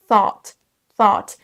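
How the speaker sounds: THOUGHT avec un O qui traîne; prononciation modèle. Shown as a demonstration. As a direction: dis THOUGHT en allongeant un peu le O. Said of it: "vont se prononcer comme un peu un O". (prononciation anglaise soignée)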